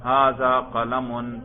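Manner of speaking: drawn-out, careful sentence reading; stretched classroom recitation, each word distinct; slow modelling. Shown as a demonstration. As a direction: read it out slowly for pupils to follow. Teacher models the whole sentence.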